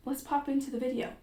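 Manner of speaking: light mock-English accent; put-on English accent. Host moving on into the video, put-on English accent. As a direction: keep up the fake English accent. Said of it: "switch to an English accent".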